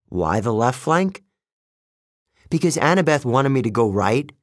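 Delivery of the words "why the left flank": ordinary delivery, nothing marked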